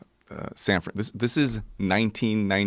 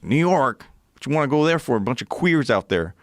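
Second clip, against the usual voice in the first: higher, mocking old cowboy scoffing at New York; 6 semitones higher than usual. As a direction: ham up the cowboy's disbelief. Quoting the cowboy's reaction.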